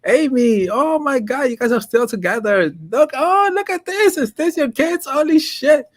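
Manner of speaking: very high, gushing reunion voice; squealing excited recognition; excited greeting. Retold or imitated. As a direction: burst over with delight at old friends.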